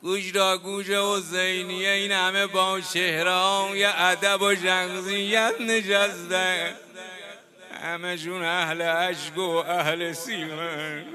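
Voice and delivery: loud, drawn-out mourning delivery, close to chant; wailing lament preacher. Acted. Preacher moves weeping mourners over Zeynab, wailing. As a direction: cry out the grief to the congregation.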